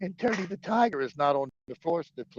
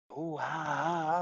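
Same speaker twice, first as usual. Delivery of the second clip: mocking imitation; 4 dB quieter, much slower than usual. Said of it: "the Don's like".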